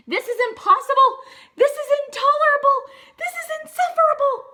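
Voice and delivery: loud, high, fast angry shouting; high loud fast furious bear rant; furious cry. Acted. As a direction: explode in rising outrage. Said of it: "cried Bear, shaking with anger".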